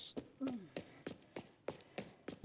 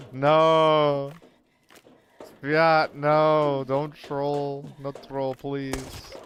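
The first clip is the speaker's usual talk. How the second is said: loud, high no, fading into pleading; pleading with teammate Fiat not to troll, shouting; panicked shout; 5 semitones higher, 7 dB louder, much slower than usual. Real, not acted. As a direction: yell to stop a teammate, then beg.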